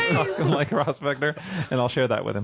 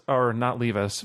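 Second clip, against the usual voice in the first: usual speaking voice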